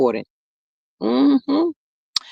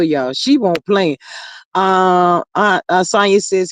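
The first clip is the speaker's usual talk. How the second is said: usual speaking voice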